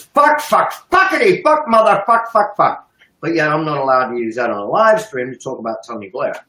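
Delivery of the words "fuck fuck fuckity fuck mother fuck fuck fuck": much higher, rattling off swear words; high-pitched mock cursing rattled off. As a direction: parody a TV show's constant cursing, pitched way up. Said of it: "you turn on House of Cards, it's".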